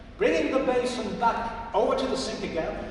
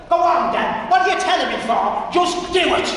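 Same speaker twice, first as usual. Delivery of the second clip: much higher, louder impatient barking; cheeky order; 9 semitones higher, 10 dB louder than usual. Acted. Impatient old man, cheeky bark. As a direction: Pat ordering Michael to fetch the basin.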